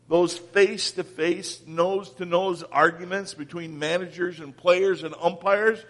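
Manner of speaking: usual speaking voice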